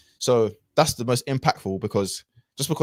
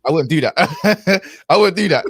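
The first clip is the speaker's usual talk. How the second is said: laughing through the words, higher and louder; 8 semitones higher, 7 dB louder than usual. Real, not acted. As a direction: crack up at yourself while saying it. Laughing at his own past self.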